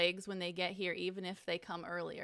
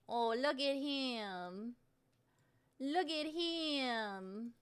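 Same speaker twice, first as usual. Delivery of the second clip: cooing; much slower than usual. Real, not acted. Streamer gushing over her dog on camera, slow coo. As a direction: melt over him, drawn out.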